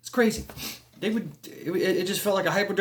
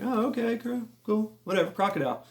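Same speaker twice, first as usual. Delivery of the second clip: higher, offhand acceptance; casual shrug-off; 7 semitones higher, 4 dB quieter than usual. Retold or imitated. Seeing a crocodile far off, casually unimpressed. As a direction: brush it off as no big deal.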